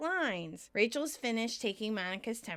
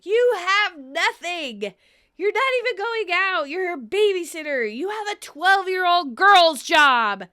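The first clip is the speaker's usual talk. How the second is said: Kızgın, yüksek sesle bağırarak; yavaş ve öfkeli bağırış; shouting; 8 semitones higher, 11 dB louder than usual. Retold or imitated. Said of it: "Monica interrupts, shouting".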